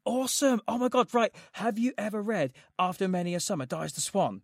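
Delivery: high, loud, eager gushing; thrilled to find a fellow fan; overexcited book fan quoting himself; excited. Retold or imitated. Excited self quoted.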